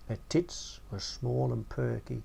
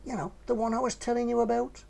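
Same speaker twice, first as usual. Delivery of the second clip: 1 lightly voiced young lad; 6 semitones higher than usual. A narrator's reading. Narrator voices himself in dialogue.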